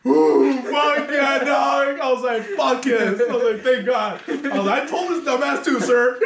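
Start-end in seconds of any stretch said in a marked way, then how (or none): 0.1-4.2 loud, high cheer from the story; retold elation. Barber replaying his reaction to the dad, elated. 4.8-6.2 loud, high, laughing I-told-you-so; retold vindication. Barber replaying his reply to the client's dad, gleefully vindicated.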